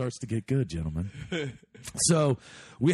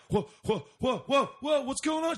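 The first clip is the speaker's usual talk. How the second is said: high-pitched, startled stammer of whoas; alarmed; 12 semitones higher than usual. Retold or imitated. Man reacting to a screaming girl, alarmed and high. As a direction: act out being thrown, voice climbing high.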